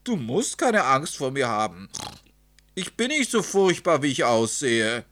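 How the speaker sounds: high, loud storybook piglet calling out; calling after someone. A narrator's reading. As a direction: call out loudly to stop him fleeing. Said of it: "rief es hinter ihm her".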